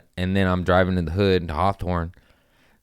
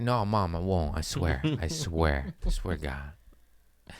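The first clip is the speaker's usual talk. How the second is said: meek promise to mom; 3 semitones lower, 7 dB quieter, slower than usual. Retold or imitated. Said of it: "I'm like".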